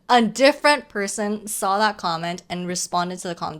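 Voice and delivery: ordinary talk, nothing marked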